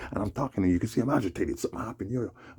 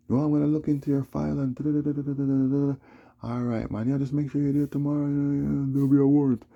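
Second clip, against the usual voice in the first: high, loud put-on voice of the officer; mocking officer voice; 10 semitones higher, 7 dB louder than usual. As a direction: play the officer, higher and louder, brushing him off. Retelling the officer's words.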